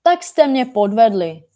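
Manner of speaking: acted outraged complaint; indignant shouting. A narrator's reading. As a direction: accuse them, sounding cheated. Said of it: "rozkřikl se pan Čapek".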